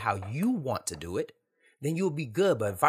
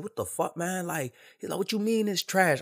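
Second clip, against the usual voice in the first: retold hostile protest in a raised pitch; 7 semitones higher than usual. Friend bristles at being told his song is trash, mock hostile. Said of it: "he got a little hostile".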